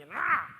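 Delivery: loud exasperated ah; sudden loud cry of exasperation; frustrated outburst. Real, not acted. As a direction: let out a short fed-up cry. Fed-up outburst over ruined coffee.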